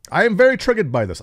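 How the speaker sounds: high, loud and fast, hammed-up outrage; mock triggered gamer. Vlogger mocking a forum poster's outrage, hammed-up high squeal. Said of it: "I can't do his accent".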